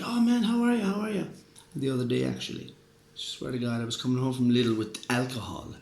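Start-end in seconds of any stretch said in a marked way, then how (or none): 0.0-1.3 higher, eager greeting; match his excitement; retold cheerful hello, pitched up; imitating own greeting. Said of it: "And I'm like".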